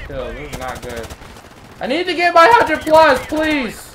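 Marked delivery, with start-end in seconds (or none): 1.8-3.8 desperate shout. Real, not acted. Gamer desperate to reach a hundred plus, desperate shout. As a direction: plead high and loud.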